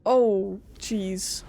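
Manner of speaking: ordinary talk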